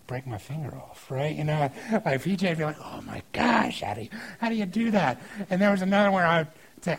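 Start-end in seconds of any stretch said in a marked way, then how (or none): 2.8-5.2 put-on amazed kid. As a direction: imitate a child's hushed amazement. Kid amazed by finger trick.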